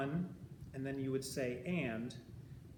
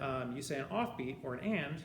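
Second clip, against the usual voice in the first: normal talking voice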